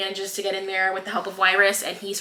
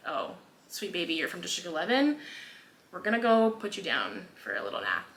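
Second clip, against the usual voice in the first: much softer, gentle and soothing; tender comfort; 3 semitones lower, 10 dB quieter than usual. Retold or imitated. Mags gently comforting the tortured girl, very soft and tender. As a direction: hush her like a frightened child.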